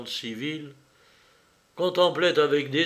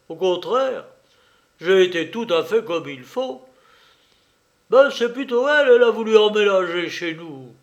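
loud, higher-pitched orderly; Schweik protesting; 5 semitones higher, 7 dB louder than usual. Acted. Orderly defends himself to his lieutenant, loud earnest protest. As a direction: protest your case loudly to your officer.

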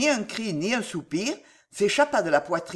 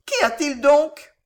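high, slow, louder anxious question; 8 semitones higher, 6 dB louder than usual. A narrator's reading. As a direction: ask in rising alarm. Wife anxious before stern husband.

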